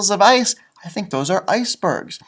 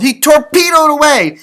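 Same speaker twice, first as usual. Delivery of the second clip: excited; 9 semitones higher, 8 dB louder than usual. A narrator's reading. Action narration.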